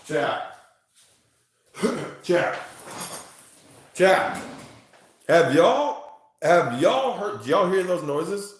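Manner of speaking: loud, high call to the chat; urgent calling out. Real, not acted. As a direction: call out, alarmed by strange noises. Startled, calls out to stream chat.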